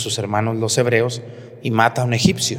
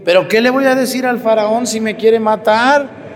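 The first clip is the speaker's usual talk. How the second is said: frightened pleading Moses; 10 semitones higher, 7 dB louder than usual. Retold or imitated. Moses fears pharaoh, pleading.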